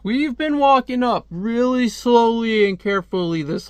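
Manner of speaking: high, put-on whiny voice; mock dim soldiers. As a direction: raise the voice into a mocking complaint. Mocks slow army guys.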